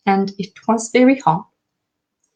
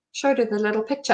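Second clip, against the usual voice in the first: usual speaking voice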